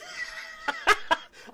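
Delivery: real laugh breaking into a high shriek. Real, not acted. Streamer cracking up at his own impression, shrieking laugh. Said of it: "I can't impersonate it".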